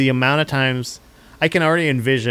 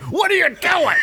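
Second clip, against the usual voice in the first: high, hammed-up shout at the wife; mock shout. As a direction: yell it in pretend outrage. Pre-argument with wife, shouting.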